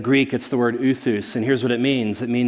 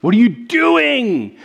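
mock John the Baptist; 9 semitones higher, 5 dB louder than usual. John the Baptist mocks the people's complaint, loud high exaggerated cry. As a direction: play a worked-up prophet throwing up his hands, hammed up. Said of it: "He's like".

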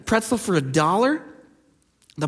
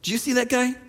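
mock gossip; 5 semitones higher than usual. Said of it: "go home and go".

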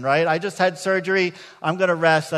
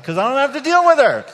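louder, higher, laughing through it; 7 semitones higher, 9 dB louder than usual. Real, not acted. Pastor confessing relief to congregation, laughing. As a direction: laugh at yourself as you admit it. Laughing at his own selfishness.

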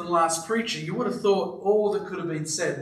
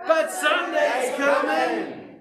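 church yelling back; 9 semitones higher, much slower than usual. Retold or imitated. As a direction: shout it back like a whole congregation. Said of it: "the whole church yelled back".

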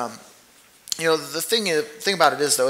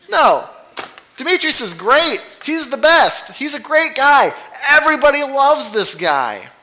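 much higher and louder, gushing; enthusiastic praise; 10 semitones higher, 6 dB louder than usual. Real, not acted. Preacher exclaiming to the congregation how great Demetrius is, excited. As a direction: rave about him like the best guy ever.

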